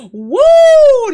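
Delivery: very high, loud woo; loud whoop. Real, not acted. Host reacting to crazy stories, loud whoop. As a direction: whoop it out high after a laugh.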